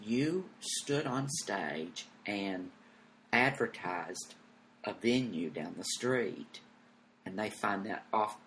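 slow, low, hushed producer; grave explanation. Retold or imitated. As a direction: spell out the offence slowly and softly. Producer explains the offence slowly.

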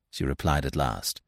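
plain reading voice

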